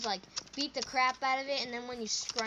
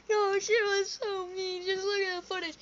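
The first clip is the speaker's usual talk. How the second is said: high, whiny mock crying; hammed-up hurt wail; tearful whine; 6 semitones higher than usual. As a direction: protest like a sobbing kid. Imitating crying boy complaining.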